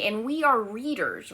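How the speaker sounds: usual speaking voice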